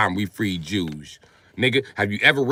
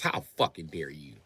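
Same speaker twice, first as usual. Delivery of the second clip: ordinary talk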